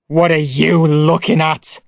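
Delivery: loud, bitter snap; father shouting at his son in anger; angry shout. Acted. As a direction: lash out at the boy. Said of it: "he shouted".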